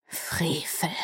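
soft, unvoiced hiss; hissed whisper. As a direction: hiss the word in outrage. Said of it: "zischte der Magier".

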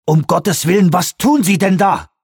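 high-pitched question full of horror; horrified outburst. A narrator's reading. Peake confronts what is being done in the lab, horrified. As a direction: blurt it out, appalled. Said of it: "voller Entsetzen ausgestoßene Frage".

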